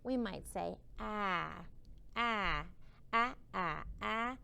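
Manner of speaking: ah repeated as a short open vowel; short A sound. Shown as a demonstration. Teacher modelling the short A for kindergarten. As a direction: chant ah, ah, ah like a drill. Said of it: "So for A".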